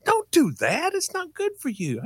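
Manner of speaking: high scolding mother voice; mom scolding. Retold or imitated. Mom warning her son off the gas fumes, high scolding voice. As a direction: imitate mom telling him off. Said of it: "And she said".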